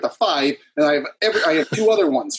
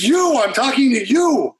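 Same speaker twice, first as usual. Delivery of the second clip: high, slow mock yell; reenacted confrontation, pitched way up; retold yell; 9 semitones higher than usual. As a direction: stretch it out, indignant and high. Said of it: "You shouldn't have yelled at me".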